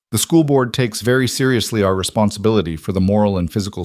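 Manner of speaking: ordinary delivery, nothing marked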